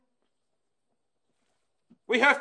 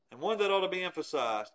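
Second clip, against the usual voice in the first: ordinary talk, nothing marked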